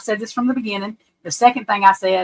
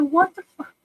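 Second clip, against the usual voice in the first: higher, incredulous mock outburst; imitating brother's disbelief; 9 semitones higher, 4 dB louder, slower than usual. Retelling brother reacting to the press conference, higher disbelief. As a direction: lightly act out the brother's shock. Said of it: "He said".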